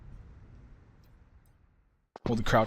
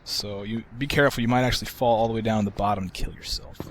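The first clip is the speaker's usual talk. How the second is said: usual speaking voice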